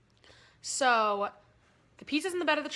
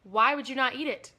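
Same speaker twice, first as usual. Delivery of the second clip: usual speaking voice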